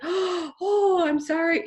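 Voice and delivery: high, gasping sorry; mock-startled oh I'm sorry; startled apology. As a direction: gasp out a shocked apology.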